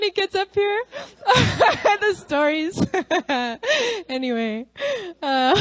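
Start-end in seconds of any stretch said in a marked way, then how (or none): 0.9-2.0 laughter. Real, not acted. Laughing at the stories.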